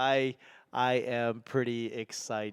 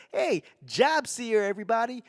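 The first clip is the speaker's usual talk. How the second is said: put-on Boston accent; 9 semitones higher than usual. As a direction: hail everyone in a Boston accent. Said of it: "steer away from the Boston accent".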